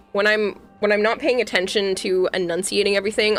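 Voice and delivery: normal talking voice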